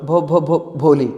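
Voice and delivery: stammering. Acted. Shy girl finally stammering out her name. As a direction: push out the name through a stammer. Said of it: "ऐसे हकलाते हकलाते अपना पूरा नाम".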